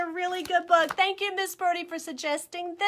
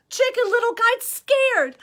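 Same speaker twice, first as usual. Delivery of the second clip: ordinary delivery, nothing marked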